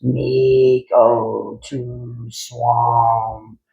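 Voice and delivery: very slow, drawn-out croaked words; croaking monster frog. Acted. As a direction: croak it heavily like a dim frog. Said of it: "he croaked".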